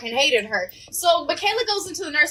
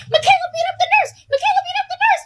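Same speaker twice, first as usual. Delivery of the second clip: much higher, loud, fast shouting; excited shout; 14 semitones higher, 4 dB louder, faster than usual. Retold or imitated. Kids running to classrooms shouting the news. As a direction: yell the news down the hall.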